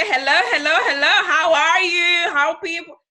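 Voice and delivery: acted greeting. Shown as a demonstration. Speaker greeting everyone as she arrives, acting. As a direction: put on a loud, effusive welcome. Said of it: "I'm acting".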